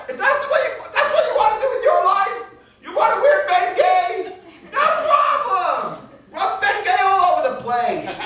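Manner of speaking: very high, hammed-up screeching rant; ranting angry mother. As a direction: play a mother screaming her head off at her son. Mother rants at son, acted.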